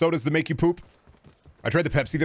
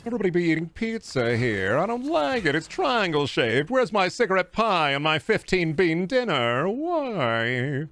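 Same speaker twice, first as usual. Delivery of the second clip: put-on high, wailing old-movie complaint; hammed-up bewildered anguish; Jimmy Stewart; 5 semitones higher, slower than usual. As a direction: plead in stretched, swooping vowels. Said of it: "I could have been Jimmy Stewart".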